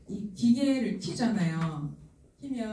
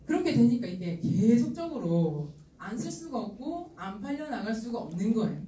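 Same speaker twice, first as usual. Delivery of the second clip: usual speaking voice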